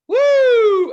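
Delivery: long, loud falling-ride "woo"; high whoop. Retold or imitated. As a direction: whoop like you're dropping down a hill.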